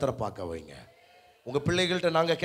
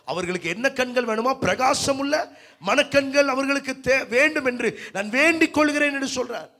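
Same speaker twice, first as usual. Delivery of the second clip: raised, ringing sermon voice; preaching; 9 semitones higher, 7 dB louder than usual. Acted. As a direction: preach the verse out high and forceful. (பிரசங்க தொனியில் உரத்து)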